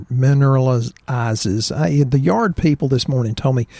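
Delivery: normal talking voice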